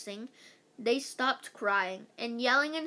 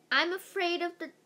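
higher-pitched scared first-grader whine; whining; 6 semitones higher, 4 dB louder than usual. A narrator's reading. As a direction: whine like a frightened little kid. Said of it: "whined one of the first graders".

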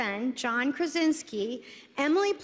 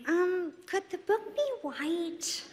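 lightly voiced executive, softly tentative, slow and higher; hesitant executive; 4 semitones higher, 4 dB quieter than usual. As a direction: float the question gingerly, as a mock executive. Imitates a timid studio executive asking.

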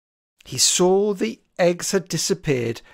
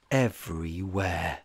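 plain reading voice

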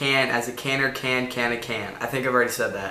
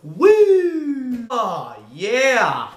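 high, loud triumphant whoop; excited cheer; 10 semitones higher, 5 dB louder, much slower than usual. Real, not acted. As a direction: celebrate the win out loud. Nails the hard tongue twister.

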